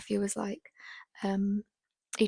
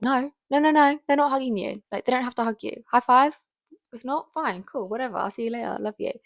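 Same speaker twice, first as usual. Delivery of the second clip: brisk, breezy; 4 semitones higher, 7 dB louder than usual. Retold or imitated. Her firm reply to grandparents.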